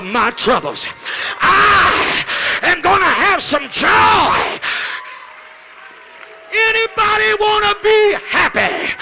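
loud vow that climbs to a shout; preaching at full force. Acted. Preacher calling the church to lay down its burdens, shouting. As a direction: build from firm resolve to a cry of joy.

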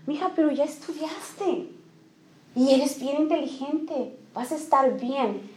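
más suave, una mamá que da confianza; madre que anima. Retold or imitated. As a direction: tranquiliza a la hija con cariño. (la mamá le asegura a su hija que le irá bien en el examen, suave)

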